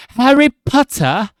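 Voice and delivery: yelled very high and loud, slow, in a hammed-up British accent; mock British Harry Potter voice. Streamer shows off his only British impression, a hammed-up shout. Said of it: "That one is pretty convincing".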